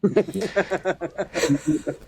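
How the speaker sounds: bright high burst of laughter; real laugh. Real, not acted. Guest laughing at the Roger joke, high burst of laughter.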